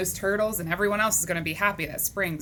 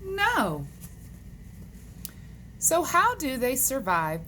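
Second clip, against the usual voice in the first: lively, higher-pitched picture-book reading; read-aloud to children; 4 semitones higher than usual. Acted. Teacher reading animal questions to boys and girls, animated read-aloud. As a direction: read it to kids with big pitch swings.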